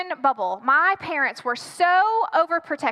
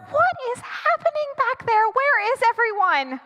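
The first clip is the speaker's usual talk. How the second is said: high, strained mock panic; panicked plea; 7 semitones higher than usual. Girlfriend left alone with the doorbell, panicking. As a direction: plead upward for someone to come.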